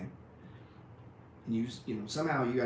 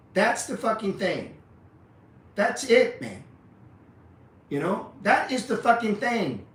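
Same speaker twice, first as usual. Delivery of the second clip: higher, louder, fired-up tirade; heated rant; 5 semitones higher, 4 dB louder than usual. Real, not acted. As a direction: blast the panic sellers. Ranting at timid traders.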